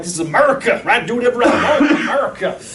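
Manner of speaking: caricatured loud-mouthed American; mock brash patriot. Those people walking around claiming freedom, mock brash. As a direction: play a swaggering freedom-lover for laughs. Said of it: "those people that walk around like".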